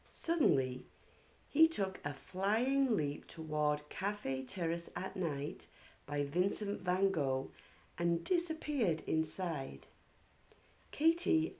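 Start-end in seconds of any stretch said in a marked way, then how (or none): none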